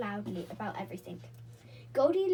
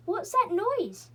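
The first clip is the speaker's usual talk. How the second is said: high, loud, slow startled question; sudden alarm; 10 semitones higher, 8 dB louder, slower than usual. A narrator's reading. Goldilocks hears snoring, suddenly alarmed. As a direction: catch a sound and freeze. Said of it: "said Goldilocks suddenly".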